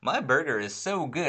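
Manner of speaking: mocking kid actors in an ad break, high and gushing. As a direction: ham up cheesy kids loving their Happy Meals. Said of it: "the kids are like".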